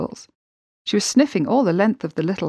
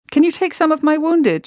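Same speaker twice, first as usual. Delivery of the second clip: high, loud call for help; cried out; 10 semitones higher, 5 dB louder than usual. Acted. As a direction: call out across the mud to the other crew. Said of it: "she cried".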